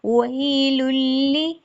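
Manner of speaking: वैलुल्ली with a clean short i after ल, slow; clear kasra under lam. Shown as a demonstration. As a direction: say वैलुल्ली with a crisp ि on ल. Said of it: "जेर है उसकी दायगी मजहूल ना हो". (वैलुल्ली में ज़ेर साफ़)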